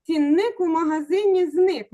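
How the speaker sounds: slow, stressing "Цінник" on the first syllable; rhyme demo of stress. Shown as a demonstration. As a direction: lean on "ЦІнник" and "магазИні", slow. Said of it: "Наголос на «і»".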